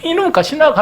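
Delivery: high, loud incredulous snap through laughter; dumbfounded robber. Retold or imitated. The robber reacts to the sister's scolding, incredulous and loud. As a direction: play the stunned robber, laughing. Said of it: "하면서".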